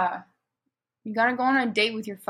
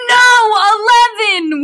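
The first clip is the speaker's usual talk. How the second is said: high, loud wailing shout; hammed-up cry, pitched way up; sad scream; 9 semitones higher, 10 dB louder, much slower than usual. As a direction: scream it out in mock despair. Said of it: "we screamed sadly".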